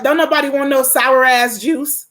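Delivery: ordinary talk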